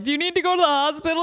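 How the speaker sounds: mock worried friend. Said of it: "And we're like".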